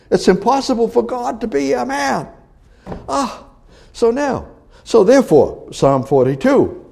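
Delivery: high, insistent preacher's voice; impassioned preaching. Real, not acted. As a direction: argue it hard from the pulpit, pitched high. Preaches at full force.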